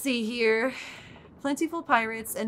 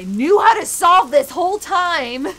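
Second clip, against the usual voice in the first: higher, louder frustrated yell; exasperated shout; 10 semitones higher, 13 dB louder than usual. Real, not acted. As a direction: vent it at the puzzle. Venting at annoying puzzle.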